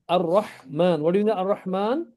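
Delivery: normal talking voice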